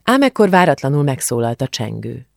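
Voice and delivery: ordinary delivery, nothing marked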